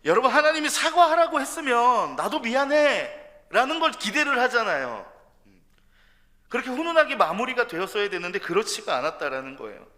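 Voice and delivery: high, loud impassioned preaching; preaching at full force. Real, not acted. Preacher pleading with the congregation, full-force preaching. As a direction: drive it home to the congregation.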